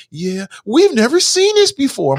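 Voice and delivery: hammed-up teen swearing it never happens. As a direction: parody of a shocked news interviewee.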